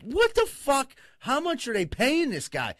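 incredulous outburst. Real, not acted. Reacting to the psychic's fee, high incredulous outburst. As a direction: blurt it out in shocked disbelief.

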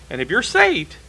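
ordinary talk

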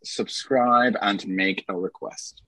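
spelled out slowly for the audience; slow it right down, each word placed; slow, deliberate repeat. Shown as a demonstration. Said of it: "Did you hear that, audience?".

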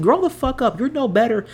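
fed-up shout at grown adults who bully; exasperated outburst. Real, not acted. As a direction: pitch jumps high on an angry grow the fuck up. Angry rant at adult bullies.

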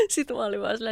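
usual speaking voice

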